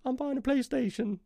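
high, soft put-on innocent voice; squeaky innocent act; mock innocent reply. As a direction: play it sweetly innocent to a cop. Imagined reply to police, high voice.

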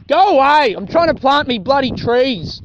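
hammed-up cranky old man; grumpy grandpa. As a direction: shoo the kids off like an old farmer. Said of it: "He's like".

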